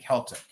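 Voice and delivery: "Celtic" as KEL-tik; K sound to begin "Celtic"; hard-K pronunciation. Shown as a demonstration. Seller reporting viewers' verdict, "Celtic" with a K. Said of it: "everyone's saying Celtic".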